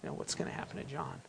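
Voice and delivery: very soft, quick casual question; mild curiosity. Acted. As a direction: toss it out under your breath, idly curious. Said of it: "kind of mild curiosity".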